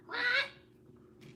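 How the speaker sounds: squeaky high rising what; high mock squeak. Pretending to be spooked by a kid, squeaky. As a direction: squeal it in fake panic. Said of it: "I'd be like".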